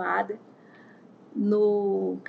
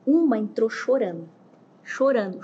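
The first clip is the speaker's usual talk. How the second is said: usual speaking voice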